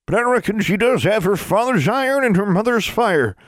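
high, raspy hill granny; old granny voice. A narrator's reading. Granny speaks.

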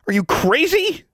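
very high, loud outburst; incredulous yell. A narrator's reading. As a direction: yell in sheer disbelief. Boy yelling at his friend.

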